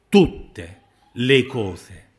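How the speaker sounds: "Tutte" loud, pause, then "le cose"; loud, very slow "Tutte le cose"; emphatic restatement. Real, not acted. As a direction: slowly split "Tutte le cose" for weight. Preacher restates the promise emphatically.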